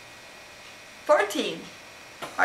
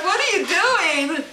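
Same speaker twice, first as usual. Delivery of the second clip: mock cat voice; 8 semitones higher, slower than usual. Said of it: "He's looking at you like".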